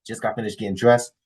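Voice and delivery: usual speaking voice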